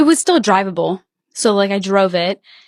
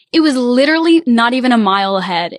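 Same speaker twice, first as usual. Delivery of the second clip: normal talking voice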